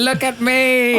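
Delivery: put-on high, drawn-out show-off kid cry; attention-seeking child. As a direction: imitate a small child demanding an audience. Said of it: "I'm just like".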